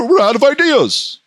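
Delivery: high, goofy ridicule; mock writers' voice. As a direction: play clueless writers in a silly high voice. Mocks the show's writers.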